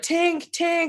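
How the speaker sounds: high ringing "tink" imitation; hammer clink. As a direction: imitate small metallic hammer taps, high-pitched.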